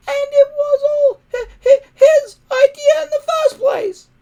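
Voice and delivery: very high, loud, squeaky dragon shriek; outraged little dragon. Acted. Toothless in Hiccup's ear, outraged and shrieking. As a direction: squeal it out in tiny fury. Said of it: "outraged in Hiccup's ear".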